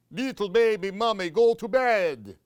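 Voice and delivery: high, loud and fast; fussy vampire bat. Acted. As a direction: rattle it off like a bossy bat. Drac scolds baby mummy.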